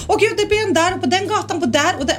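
high, frantic mock panic; imitating a friend freaking out over the app; panicky friend. Friend with the app, reading off nearby offenders in mock panic.

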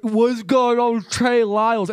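mock slurred question with a numb mouth; imitating numb speech. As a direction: fake the struggle of a numbed tongue. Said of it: "it was such a struggle".

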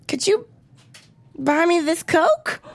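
high, slow and timid; timid ask. Retold or imitated. To her date, a high, sheepish request. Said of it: "I'm like".

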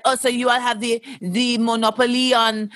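mock French accent meant as Russian; accidental French accent. Imitating Russian girls on Instagram in a mock French accent. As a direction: slip into a hammy French accent. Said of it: "that's a French accent".

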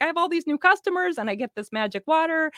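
happy bead store owner raving; delighted shop owner. Retold or imitated. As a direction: imitate her thrilled customer. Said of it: "She's like".

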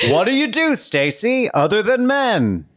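high, loud, stretched-out question; mock interrogation. Man quizzing dancer on real life, high mock interrogation.

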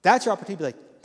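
normal talking voice

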